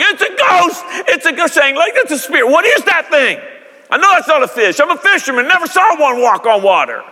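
high, excited panic about a ghost; mock-frightened disciples shouting ghost; panicked fishermen. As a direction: squeal it like scared fishermen.

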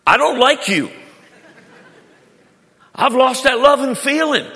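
high, loud comic complaint; exaggerated high-pitched grumble at a spouse; mock marital gripe. Spouse's thought acted for laughs.

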